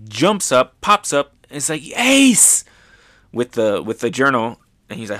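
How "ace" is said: sudden high excited shout of a name; excited call. Retold or imitated. Yamato jumps up and calls to Ace, high excited shout. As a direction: leap up and call out to him.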